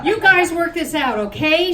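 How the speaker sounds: a touch of a pleading rep. Acted. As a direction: beg them to stop. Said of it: "she pleaded".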